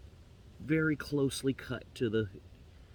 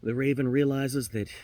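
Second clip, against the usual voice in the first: usual speaking voice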